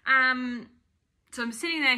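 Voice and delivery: usual speaking voice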